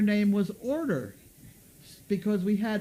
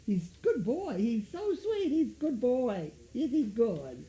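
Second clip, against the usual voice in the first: high sing-song praise for the dog; doggy praise voice; 5 semitones higher than usual. Real, not acted.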